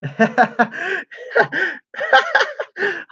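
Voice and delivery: long high giggling fit; big amused laugh. Real, not acted. Streamer cracking up at lively chat, amused laughter.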